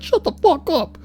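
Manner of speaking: high put-on voice; imitating the rude retort; mock retort. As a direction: fire it back like the guy. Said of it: "He's like".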